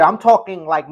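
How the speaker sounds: ordinary talk, nothing marked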